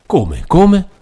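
high, loud disbelieving cry; shocked. Acted. Mother stunned to hear her daughter cannot marry, crying out in disbelief. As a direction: jolt up in disbelief.

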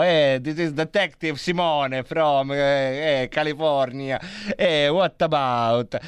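slow detective line in thick Italian-accented English; mock Italian detective accent; spaghetti-western Italian accent. Shown as a demonstration. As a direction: play an Italian cop speaking English. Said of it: "un inglese con l'accento italianissimo".

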